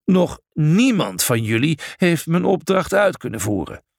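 iets hoger, nadrukkelijke toespraak; streng personage. A narrator's reading. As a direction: spreek de groep nadrukkelijk toe. Personage spreekt groep streng toe. (nadrukkelijk, als een strenge opdrachtgever)

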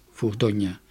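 usual speaking voice

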